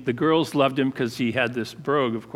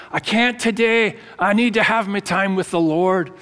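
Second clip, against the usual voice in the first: higher, lightly imitated Edinburgh brogue; Scottish brogue; 4 semitones higher than usual. Head dishwasher retells young Scottish teammate dodging dishes, imitated Edinburgh brogue. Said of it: "that thick, you know, Edinburgh brogue".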